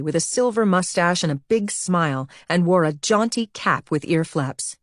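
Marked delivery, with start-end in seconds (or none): none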